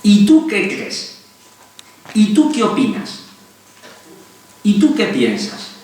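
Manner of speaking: slow, gentle questions stressing tú; doctor modelling reflective questions for a patient. Shown as a demonstration. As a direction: ask slowly, leaving room to think. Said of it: "preguntas reflexivas".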